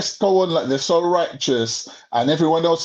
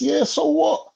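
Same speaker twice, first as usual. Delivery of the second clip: high, dismissive so what; mock careless retort pitched high; brazen retort; 11 semitones higher, slower than usual. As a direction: shrug it off like a caught narcissist. Narcissist's brazen retort quoted.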